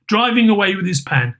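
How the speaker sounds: ordinary delivery, nothing marked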